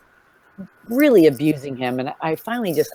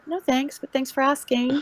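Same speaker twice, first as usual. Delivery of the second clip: sweet polite voice; 10 semitones higher, 5 dB quieter than usual. Retold or imitated. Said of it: "And I go".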